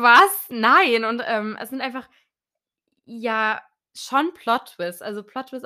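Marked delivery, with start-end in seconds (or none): 0.0-1.0 loud, shocked outburst; retold disbelief. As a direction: blurt it out in disbelief. Retells disbelief at plot twists.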